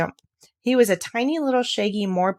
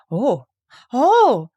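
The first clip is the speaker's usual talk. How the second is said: high, loud cry of joy; delighted cry; 4 semitones higher, 6 dB louder than usual. Acted. Colin hearing Dickon will visit, delighted cry. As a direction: burst out with delight. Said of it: "Colin cried out in delight".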